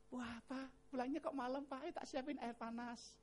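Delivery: soft, gentle welcome home; sweet doting wife. Acted. Wife greets husband on payday, sweet and very soft. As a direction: coo it softly to a tired husband.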